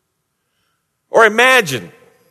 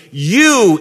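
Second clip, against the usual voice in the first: "you" said higher and louder, in bold caps; emphatic you; 6 semitones higher, 3 dB louder than usual. Shown as a demonstration. Preacher explaining the Greek, stresses "you" emphatically. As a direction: hit "you" like it's underlined. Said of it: "in bold caps underlined".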